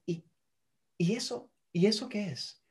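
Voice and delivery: soft therapist probing; soft curious prompt. Shown as a demonstration. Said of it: "Yo a veces hasta hago".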